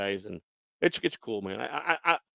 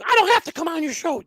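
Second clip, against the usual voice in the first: very high put-on voice; High Pitch Eric; 14 semitones higher, 3 dB louder than usual. Man refusing to come on a show, high-pitched impersonation. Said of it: "really good high pitch Eric impersonation".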